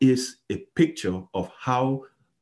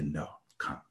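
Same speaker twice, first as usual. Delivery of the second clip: kind invitation; 7 semitones lower, 15 dB quieter than usual. Acted. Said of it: "He will kindly restore them".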